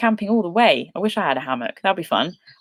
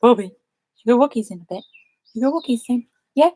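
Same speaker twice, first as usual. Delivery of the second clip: talking to a dog; 6 semitones higher, faster than usual. Real, not acted. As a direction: coo it at the dog.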